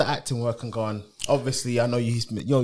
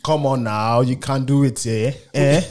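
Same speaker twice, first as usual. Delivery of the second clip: put-on African accent, playful; slower than usual. Shown as a demonstration. Host showing guest how easy it is, African accent. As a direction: coax in an African accent with a tagged-on "eh?". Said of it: "Can you do an African accent?".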